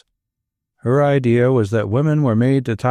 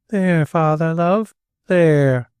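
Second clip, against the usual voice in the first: gentle soothing; 5 semitones higher, much slower than usual. A narrator's reading.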